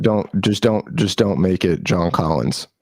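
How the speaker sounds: usual speaking voice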